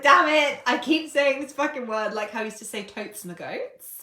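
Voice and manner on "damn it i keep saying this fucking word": loud, high mock exasperation; raised, laughing self-scolding; laughing at herself. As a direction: curse yourself with amused exasperation. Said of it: "I'm laughing at myself".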